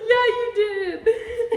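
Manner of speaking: laughing. Real, not acted. Teasing friend, laughing.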